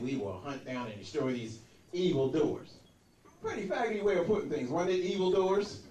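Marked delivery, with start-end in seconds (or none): 0.0-2.7 low, deep George Bush voice; solemn presidential address, pitched down; Bush impression. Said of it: "the president that said".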